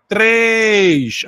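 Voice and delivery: "três" as one long, loud syllable; one unbroken syllable. Shown as a demonstration. Teacher showing a monosyllable, "três" held long and loud. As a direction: stretch "três" out without breaking it. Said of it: "aqui não tem separação".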